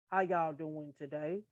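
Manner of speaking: low, soft, monotone greeting; deep monotone. Shown as a demonstration. Demonstrating how hard-of-hearing people talk, deep monotone. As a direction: drop the voice deep and keep it monotone. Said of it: "they gotta kinda talk deep".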